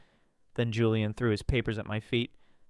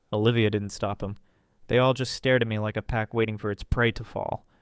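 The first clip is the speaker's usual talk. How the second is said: ordinary delivery, nothing marked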